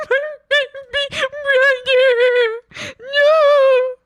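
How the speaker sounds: very high, loud mock wail; hammed-up wail. Imagining running into the hospital, hammed-up wail. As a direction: wail it like a hammed-up distraught sibling. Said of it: "I'd run in and be like".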